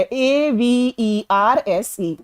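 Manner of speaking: slow, careful letter-by-letter dictation; slow spelling. Shown as a demonstration. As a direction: spell each letter slowly and evenly. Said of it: "teacher spells the verb".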